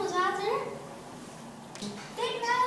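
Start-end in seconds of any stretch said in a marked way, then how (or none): 2.2-2.6 imploring, pitched up; pleading. A narrator's reading. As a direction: beg with a high, urgent note. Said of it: "smeekte juffrouw Spin".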